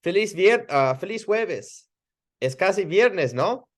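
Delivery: high, playful greeting ending in no?; informal cheerful greeting. Shown as a demonstration. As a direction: toss the question to them casually. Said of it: "Or I might say".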